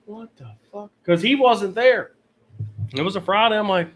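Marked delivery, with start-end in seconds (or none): 0.1-0.9 much softer, under the breath; retold quiet disbelief. Retelling arriving to find him gone, hushed disbelief. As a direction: drop the volume for a baffled aside.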